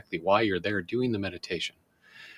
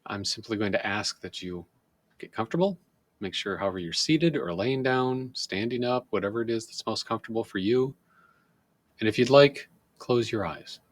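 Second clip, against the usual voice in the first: slow, calm meditation guide voice with long pauses; guided meditation. Shown as a demonstration. Demonstrating guided meditation for viewers, slow soothing guide voice. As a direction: ease the listener down, body part by body part. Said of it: "example of how we do guided meditation".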